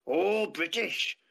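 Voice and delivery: slow, drawn-out imitation of a guard; impressed local guard. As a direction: mimic the guard's surprised reply. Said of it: "I was".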